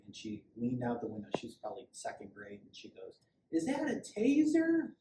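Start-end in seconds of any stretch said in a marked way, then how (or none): 3.5-4.9 put-on high, loud, drawn-out kid's yell; yelled child's question. Second grader calling to an officer from a car window, yelling. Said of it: "she yelled out the window".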